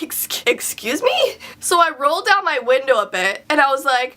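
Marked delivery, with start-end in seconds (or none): 0.0-1.4 high, slow, stammered mock disbelief; offended disbelief, acted out; taken aback. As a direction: imitate an affronted double take, rising in pitch. Retold reaction to being insulted.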